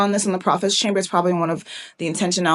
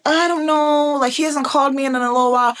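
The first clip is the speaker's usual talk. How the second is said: mock a reluctant queen, pitched up and sustained; hesitant Esther; 6 semitones higher than usual. Preacher voicing Esther's reply to Mordecai, mock hesitant.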